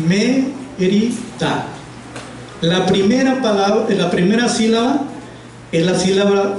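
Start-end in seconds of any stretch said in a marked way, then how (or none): 0.1-1.7 "Mérida" split slowly into three separate syllables. Shown as a demonstration. Teacher counting the syllables for students, "Mérida" slowly broken up. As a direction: say "Mérida" syllable by syllable, pausing between each. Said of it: "separada por sílaba".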